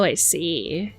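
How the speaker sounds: normal talking voice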